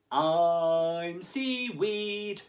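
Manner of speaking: very slow swaying voice; slow dreamy. A narrator's reading. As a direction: stretch it out like drifting seaweed. Sammy pretends to be seaweed.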